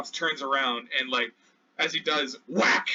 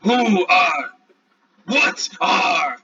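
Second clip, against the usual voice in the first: broken dinosaur speech; 8 dB louder, much slower than usual. Acted. Triceratops speaking broken words.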